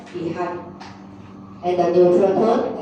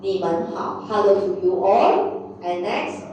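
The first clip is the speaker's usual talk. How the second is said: usual speaking voice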